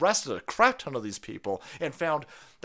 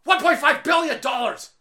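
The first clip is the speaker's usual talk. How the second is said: a real furious shout, much higher and louder; 13 semitones higher, 9 dB louder than usual. Real, not acted. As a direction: yell the number in outrage. Shouting the fine amount.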